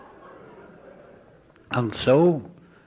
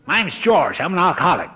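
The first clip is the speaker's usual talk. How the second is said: hearty greeting; 8 semitones higher, 4 dB louder than usual. Retold or imitated. George's hearty greeting at door.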